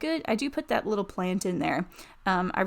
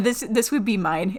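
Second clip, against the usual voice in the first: ordinary talk, nothing marked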